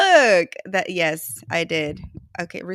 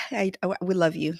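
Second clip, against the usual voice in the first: ordinary delivery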